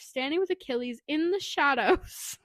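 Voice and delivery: ordinary delivery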